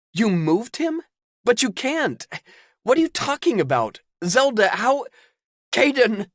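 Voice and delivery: high, loud stunned questions; can't believe what he hears; shocked. Acted.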